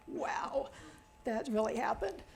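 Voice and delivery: soft, whispery amazement; acted quiet wonder, as if it just dawned; hushed realisation. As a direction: say it under your breath, stunned.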